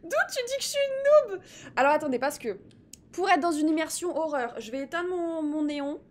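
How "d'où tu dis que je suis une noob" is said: very high, loud squeal of protest; mock indignation. Streamer protests a viewer's noob sticker, mock-indignant squeal. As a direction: squeal it, pretending to be outraged.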